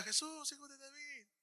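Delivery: high but soft, held-back call; mock feeble cry. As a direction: call out weakly, as if not really needing it. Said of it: "No pienso que estaba".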